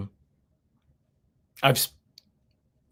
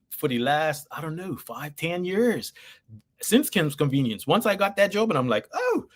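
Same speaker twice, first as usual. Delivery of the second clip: ordinary delivery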